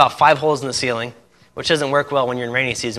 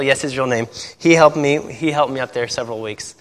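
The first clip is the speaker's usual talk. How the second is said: ordinary talk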